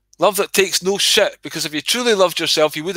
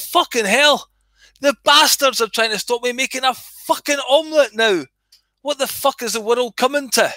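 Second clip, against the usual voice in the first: outraged inner rant; 7 semitones higher than usual. Retold or imitated. Retelling his outraged thought.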